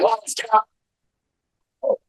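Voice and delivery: high, strained attempt at talking; lost voice. Greeting a man at the event with a lost voice. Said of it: "I lost my voice".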